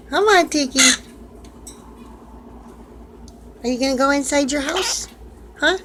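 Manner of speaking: high, sweet voice cooing at a pet. Real, not acted. Owner calling to her parrot, high cooing baby talk. Coaxing bird inside house.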